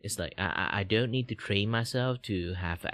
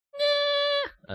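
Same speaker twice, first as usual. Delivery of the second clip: a sudden squeak far higher on "Nah"; high swooping nah; 5 dB louder than usual. Shown as a demonstration. Showing how the voice jumps high, a squeaky nah. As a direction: jump way up in pitch for a dismissive nah. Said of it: "I go high and low".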